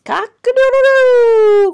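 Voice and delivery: loud, very high rooster crow; piercing storybook cock-a-doodle-doo. A narrator's reading. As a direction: crow like a rooster, holding the end. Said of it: "crowed Rooster".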